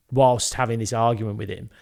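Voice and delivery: ordinary delivery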